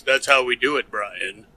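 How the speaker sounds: low, soft, seductive purr; sultry voice. Pretend. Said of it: "Engage sultry voice".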